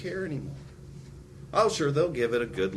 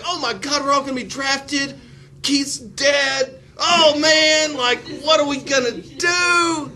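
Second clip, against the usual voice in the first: hammed-up frantic panic, much higher; freaking out; 7 dB louder, slower than usual. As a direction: wail it like total mock panic. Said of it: "you're all freaking out".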